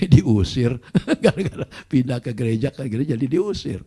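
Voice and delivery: amused, laughter breaking into words; laughing while talking. Real, not acted. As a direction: laugh through the retelling. Laughs telling of being expelled. (bercerita sambil tertawa)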